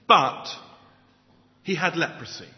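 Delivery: but punched loud, pause, then he had leprosy; dramatic stress. Shown as a demonstration. Preacher reading the shocking twist, loud but before the pause. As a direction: hit but hard, hold, then drop it. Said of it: "written to be shocking".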